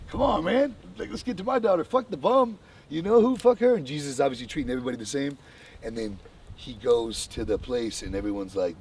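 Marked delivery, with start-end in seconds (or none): 0.1-3.8 high, loud, exasperated pleading; impatient angry father. Retold or imitated. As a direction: push him to hurry, fed up and shouting. Desperate father urging Jesus on.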